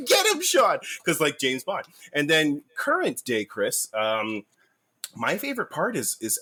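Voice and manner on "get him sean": high, loud cheering shout; teen fan shouting at the TV; excited shout. Retold or imitated. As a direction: yell at the screen for Sean. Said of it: "I was like".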